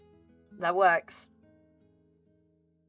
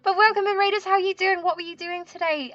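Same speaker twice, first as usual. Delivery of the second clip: high, bright welcome to raiders; cute anime streamer; 10 semitones higher than usual. Acted. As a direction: squeal hello to the incoming raid. Greeting raiders.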